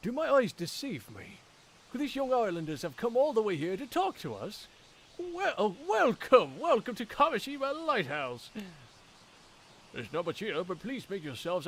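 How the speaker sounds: high, loud, grandly delighted welcome; booming, over-the-top hospitality; excited theatrical host. Acted. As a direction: proclaim it to long-awaited guests. Voices an effusive lighthouse host.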